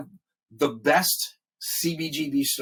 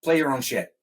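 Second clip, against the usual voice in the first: irritated sound guy; 3 semitones higher, 5 dB louder than usual. Retold or imitated. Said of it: "he goes".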